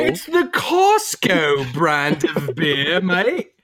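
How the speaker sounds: slow, drawn-out mock British accent; teasing a friend in a put-on British accent. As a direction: sneer it out in a posh British accent. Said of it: "Did I say it with an accent?".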